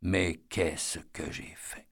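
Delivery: slow, drawn-out, tearful and hushed; weeping despair. A narrator's reading. Eddie weeping over the girl he killed, slow and tearful. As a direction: let the repeat break down into tears. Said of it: "Il pleura encore et encore".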